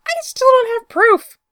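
high, loud frustrated yell. Acted. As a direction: shout it up high at your friend.

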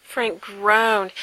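ordinary delivery, nothing marked

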